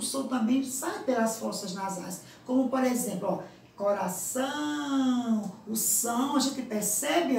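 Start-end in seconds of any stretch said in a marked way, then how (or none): 3.8-5.5 "coração" with a long, held nasal "ão"; passagem nasal destacada. Shown as a demonstration. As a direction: let the "ão" of "coração" hum on slowly. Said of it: "a passagem pelas fossas nasais". ("coração" com o "ão" nasal prolongado)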